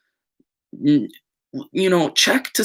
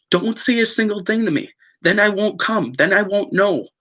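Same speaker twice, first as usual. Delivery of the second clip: exasperated raised voice; 8 semitones higher than usual. Retold or imitated. Heated imagined reply to friend.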